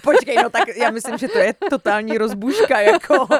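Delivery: loud, amused objection; laughing protest. Real, not acted. Listener objecting to coach's suggested question, laughing protest. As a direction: protest loudly while cracking up.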